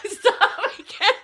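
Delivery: laughing. Real, not acted. Helpless laughter at a joke.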